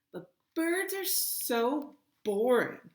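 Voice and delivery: loud, high, drawn-out whine; moaning complaint. A narrator's reading. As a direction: moan it out slowly, high and loud. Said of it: "Jake moans".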